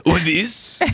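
high, laughing disbelief; mock incredulous. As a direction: scoff at the tiny weight. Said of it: "It's like".

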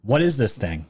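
ordinary talk, nothing marked

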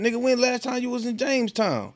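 high-pitched mock questioning; suspicious interrogation. Partner questions someone about Jamestown, high mock suspicion. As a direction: voice jumps up, acting out an accuser. Acted quarrel over catching the virus.

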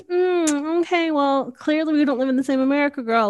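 sassy comeback. Retold or imitated. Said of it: "She goes".

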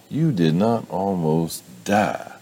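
high, slow, drawn-out denial; exasperated. Acted. Mother dismissing his near-death claim, exasperated.

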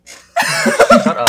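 very high, loud burst of laughter; cracking up while telling a friend off; laughing protest. Real, not acted. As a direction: squeal it through laughter.

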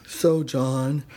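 low comic character voice; made-up character. Acted. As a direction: drop deep for an invented boss. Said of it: "Well, I made her up".

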